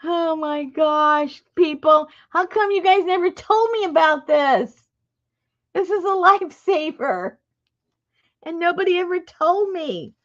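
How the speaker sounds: high-pitched excited marveling; amazed. Real, not acted. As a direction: exclaim like it's a life-changing discovery. Amazed by the needle trick.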